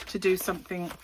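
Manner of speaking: usual speaking voice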